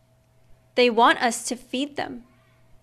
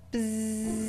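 soft bee buzz; 2 semitones lower, 11 dB quieter than usual. A narrator's reading. Bees in the flowers.

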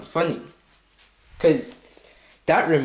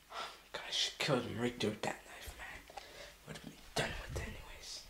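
hushed, breathy whisper under the breath; whispered aside; 6 semitones lower, 20 dB quieter than usual. Real, not acted. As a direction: mutter it so nobody hears. Angry muttered aside at video.

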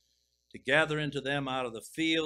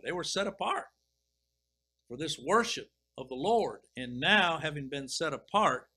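rising, louder preaching on set apart and praise; preaching build; 2 semitones higher, 5 dB louder than usual. Real, not acted. Preacher stirring the congregation to praise, louder fervent preaching. Preacher rises to full force.

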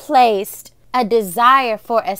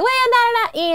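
high mock objection; mom's protest, voice pitched up; imitating mom; 12 semitones higher than usual. Retelling mom refusing the song, high imitated scolding. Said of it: "she was like".

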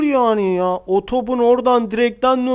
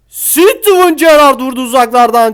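excited cheer; 5 semitones higher, 5 dB louder than usual. Real, not acted. Cheering a long shot in-game.

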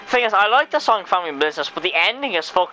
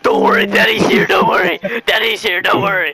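loud, repeated shouted comfort; mock screamed reassurance; 3 dB louder than usual. Imitating a dad over screaming kids, shouted. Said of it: "he's screaming it down the song".

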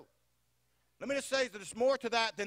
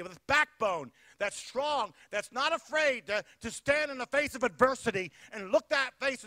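building, louder preaching; rally the men to boldness; 3 semitones higher, 4 dB louder than usual. Acted. Preacher urging the men to stand for God, louder preaching.

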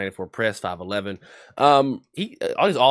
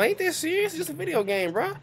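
higher teasing voice, easing down; mock teasing. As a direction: needle him in a squeaky put-on voice. Keeps mocking the angry friend.

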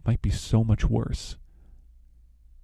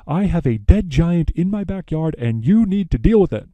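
higher and louder, a retold frantic demand; mock alarmed mother; 7 semitones higher, 6 dB louder than usual. Jack's mother bursts in on the steward, mock frantic and loud. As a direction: play the flustered mother making a hammed-up demand.

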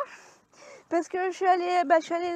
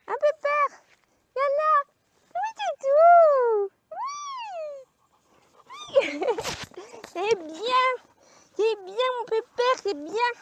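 put-on much higher cooing baby talk; 9 semitones higher, 6 dB louder, slower than usual. Shown as a demonstration. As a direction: coo to the dog like to a baby. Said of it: "tu fais une petite voix, comme si tu parlais à un bébé".

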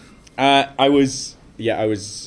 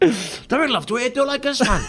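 put-on Welsh accent; 12 semitones higher than usual. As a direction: shout it like a Welsh farmer. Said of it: "I love that accent you did there".

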